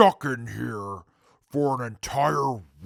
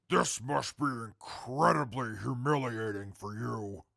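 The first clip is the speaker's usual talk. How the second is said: normal talking voice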